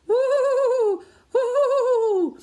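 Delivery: high, loud howling wind. Acted. Reader making the wind noise, loud high howl.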